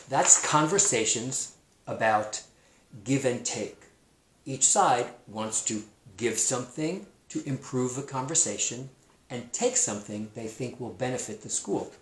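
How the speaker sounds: ordinary delivery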